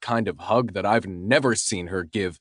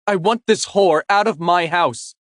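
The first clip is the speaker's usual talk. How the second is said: higher, sharp angry ex-wife; angry demand; 8 semitones higher, 5 dB louder than usual. Acted. Ex-wife ordering the girlfriend out, angry and higher. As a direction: spit out the demand.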